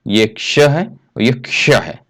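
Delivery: क्ष said slowly and carefully, twice over; slow pronunciation demo. Shown as a demonstration. Teacher demonstrates क्ष to students, slow and careful. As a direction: pronounce each क्ष with deliberate care. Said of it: "ये टंग का position जो है".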